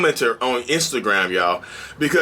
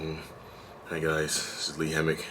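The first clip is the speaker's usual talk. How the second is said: much lower and much softer, drained; low-energy intro; 10 semitones lower, 13 dB quieter than usual. Pretend. Vlogger previewing tomorrow's drained low-mood intro. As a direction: mumble the greeting like you have nothing left. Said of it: "I'm gonna be like".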